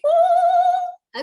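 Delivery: loud high whoop; excited woo cheer. Real, not acted. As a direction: whoop for the winner. Cheering a teammate's award.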